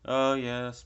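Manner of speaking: imitating the shop assistant. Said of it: "the shop assistant was like".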